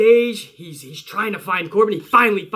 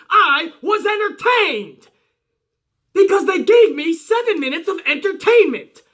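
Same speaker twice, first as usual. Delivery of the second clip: excited; 7 semitones higher than usual. Real, not acted. Fan excitedly praising segment.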